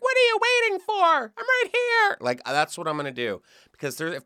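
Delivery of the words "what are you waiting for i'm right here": very high breathy come-on voice; Jennifer Love Hewitt. Pretend. To a hidden pervert watching, a very high seductive call. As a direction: coo a high seductive invitation. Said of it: "full on Jennifer Love Hewitt".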